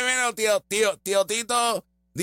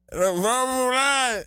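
higher, slow chant; mock rally shout; 7 semitones higher, slower than usual. Imitating her party cheer again, higher, slow and shouted. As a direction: belt the slogan like a rally. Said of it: "ella pensó que se la comió".

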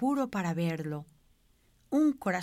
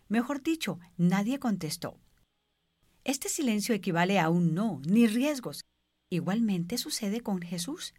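ordinary talk

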